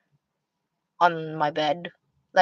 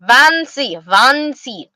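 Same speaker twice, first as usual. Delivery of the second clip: higher, playful German-sounding "Fancy"; mock German "Vancy", pitched up; 4 semitones higher, much slower than usual. Shown as a demonstration. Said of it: "it sounds like German".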